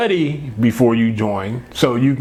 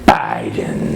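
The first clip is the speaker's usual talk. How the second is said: deliberate whisper. Pretend. Host repeating the name to his co-host, dramatic whisper. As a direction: breath-only whisper of the name. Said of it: "I gotta say it like that".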